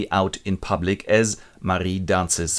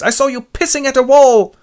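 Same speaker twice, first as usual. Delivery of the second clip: much higher, louder scolding quack; shrill mad doctor; 7 dB louder than usual. Retold or imitated. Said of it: "the doctor goes".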